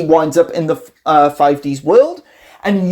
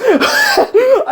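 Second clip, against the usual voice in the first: laughing hard, pitch shooting up; real laugh at the joke; high burst of laughter. Real, not acted. Fan cracking up at Paradox's line, high laugh.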